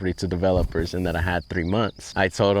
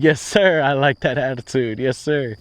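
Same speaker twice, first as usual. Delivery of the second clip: higher, louder, pumped-up agreement; much higher and louder, thrilled; eager yes sir; 6 semitones higher, 5 dB louder than usual. Retold or imitated. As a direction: fire it back like a keen recruit. Eager reply to encouragement.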